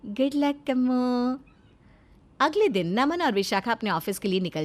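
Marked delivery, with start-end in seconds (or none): 0.1-1.4 slow, drawn-out mock good wishes; teasing. Wife teases the maid with good wishes, slow and mocking. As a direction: savour the teasing.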